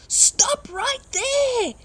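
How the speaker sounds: very high, loud, slow squeaky shout; tiny mole defying the trucks; Mole yelling. Acted. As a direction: plant your feet and yell at the diggers. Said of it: "yelled Mole".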